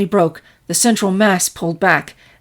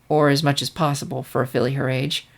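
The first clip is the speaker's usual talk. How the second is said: ordinary delivery, nothing marked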